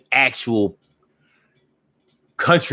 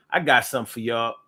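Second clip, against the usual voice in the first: normal talking voice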